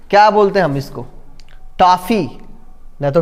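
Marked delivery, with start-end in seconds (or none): 1.8-2.4 "टॉफ़ी" with an open aw; in-between vowel. Shown as a demonstration. Teacher modelling an English word, "टॉफ़ी" with aw. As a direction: sound "टॉफ़ी" between taafi and tofi. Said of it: "बोलते हैं उसके बीच वाला".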